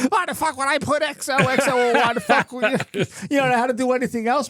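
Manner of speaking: high, heated quoted rant; ranting back at a harmless joke; furious mock rant. Coworker snapping furiously about the sign-off, pitched high. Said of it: "he got furious".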